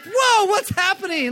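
very high, loud and slow startled cry; hammed-up alarm. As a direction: panic theatrically at the claws.

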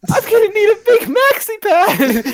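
high, slow silly voice; mock wounded guy. Imitating the bleeding guy to friends, high squeaky voice. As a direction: whine it like a goofy victim. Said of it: "he's like, he's like".

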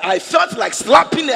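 emphatic shout. Retold or imitated. Pastor insisting to congregation, loud shout. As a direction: shout it, leaning on felt. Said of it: "I said".

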